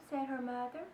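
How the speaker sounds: ordinary delivery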